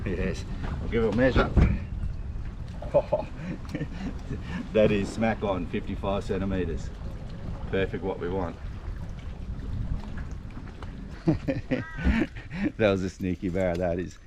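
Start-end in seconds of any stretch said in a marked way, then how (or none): 1.5-4.7 a soft, airy laugh of delight; breathy laugh. Real, not acted. Fisherman pleased at a perfect measured fish, soft laugh. 8.6-12.7 a soft laugh that rises at the end; long breathy laugh. Real, not acted. Angler delighted by a sneaky barra, quiet laugh.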